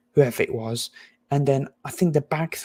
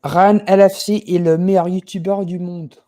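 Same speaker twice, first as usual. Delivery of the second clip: louder, confident French delivery showing off fluency; French sentence; 3 semitones higher, 8 dB louder than usual. Shown as a demonstration. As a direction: speak it like a native Frenchman, proud. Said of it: "Say it in French".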